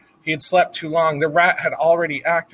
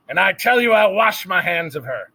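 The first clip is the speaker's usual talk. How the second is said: shouting; 5 semitones higher than usual. A narrator's reading. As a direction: yell it, washing your hands of her. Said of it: "Louise was shouting".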